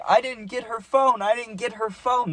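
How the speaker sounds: high-pitched imitation of panicking; put-on high, distressed plea; mock panic. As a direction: whine it like a panicking drunk friend. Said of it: "he almost started panicking".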